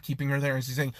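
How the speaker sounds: usual speaking voice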